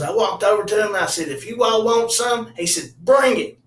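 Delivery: retold dare that climbs into a shout; mock tough challenge. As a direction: square up and dare them, rising to a yell. Uncle retells a challenge.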